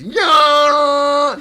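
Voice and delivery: rock singer yell. Retold or imitated. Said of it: "[name]'s voice just goes".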